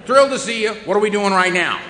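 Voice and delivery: high, loud, eager dog; bursting with puppy joy; excited dog voice. Retold or imitated.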